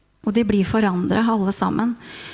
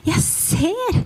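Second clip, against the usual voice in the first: joyful wonder; 8 semitones higher than usual. Acted. Joyful realisation of sight.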